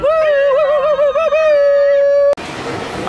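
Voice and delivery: very high, loud train-like woo-woo; shrieked falsetto woos, last one held; high whoops. Shown as a demonstration. Capping the folk song for friends, high hammed-up whooping.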